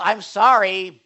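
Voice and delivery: loud, slow, begrudging "sorry"; mock insincere sorry; grudging sorry. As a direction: drag out a reluctant sorry. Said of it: "you're responsible for your own sin".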